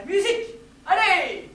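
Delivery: high, loud cue shout; calling out. Acted. Calling for the music to begin, high shout. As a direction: shout for it to start, rising in force.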